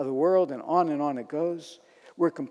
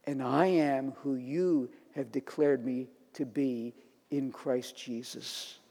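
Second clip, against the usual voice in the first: quiet, lowered voice with long pauses; slow down and speak reverently to God; prayerful declaration; 3 semitones lower, 4 dB quieter than usual. Shown as a demonstration. Preacher voicing the believer's convictions to the Lord, hushed and prayerful.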